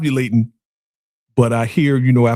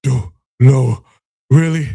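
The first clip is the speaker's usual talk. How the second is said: louder, lightly hammed-up disbelief; mock dramatic; 6 dB louder, slower than usual. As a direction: break it up like an overdramatic man. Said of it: "he's so dramatic".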